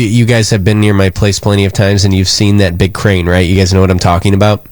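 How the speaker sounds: normal talking voice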